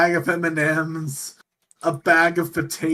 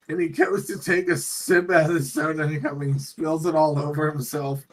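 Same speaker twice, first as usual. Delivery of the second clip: ordinary talk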